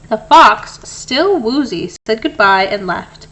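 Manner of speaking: plain reading voice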